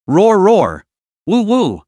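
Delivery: beastly howls. A narrator's reading.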